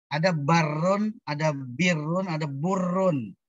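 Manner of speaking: "barun", "birun", "burun" slow, each vowel clearly apart; slowly change only the vowel: barun, birun, burun; vowel contrast. Shown as a demonstration. Teacher drilling the three vowel readings to the class, slow and clear. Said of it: "Beda harokat juga beda".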